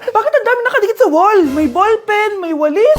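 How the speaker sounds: high, loud teacher demanding answers; shocked teacher. Acted. Teacher finds pens and brooms on the wall, shocked.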